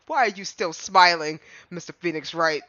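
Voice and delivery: normal talking voice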